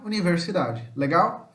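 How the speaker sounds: usual speaking voice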